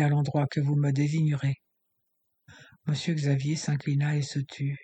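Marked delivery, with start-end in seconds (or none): none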